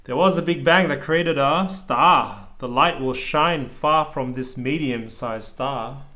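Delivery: steady rhythmic picture-book reading; reading aloud to child. A narrator's reading. Dad reading a rhyming book to his child, lilting read-aloud. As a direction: read the rhyme out to your kid.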